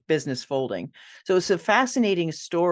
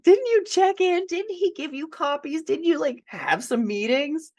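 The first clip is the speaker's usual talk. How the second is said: much higher, disbelieving questions; incredulous; 11 semitones higher than usual. Retold or imitated. Guest quizzes the client about the ghostwriter, high-pitched and incredulous. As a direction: ask it in high-pitched disbelief, as if you can't believe it.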